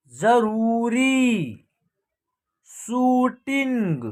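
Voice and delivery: साबूत, रूठना, भूमिका, जरूरी, शूटिंग very slow with long ऊ held; drawn-out pronunciation. Shown as a demonstration. Teacher reading long-ऊ words like साबूत, drawn out slowly. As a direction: stretch each ऊ, as in साबूत and जरूरी. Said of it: "इस प्रकार इसका उच्चारण होगा".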